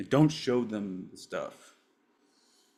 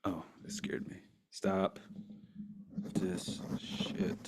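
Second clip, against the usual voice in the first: a hushed breath after a scare; startled whisper; 9 dB quieter, slower than usual. Real, not acted. To himself after a sudden scare, hushed whisper. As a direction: whisper it, still shaken.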